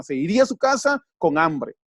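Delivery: ordinary talk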